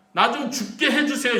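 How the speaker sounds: high, loud acted begging; desperate plea. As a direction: beg God out loud in Job's place. Acting out Job's plea to God.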